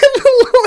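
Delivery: high squealing laugh; high burst of laughter. Real, not acted. Laughing after imitating the lamb.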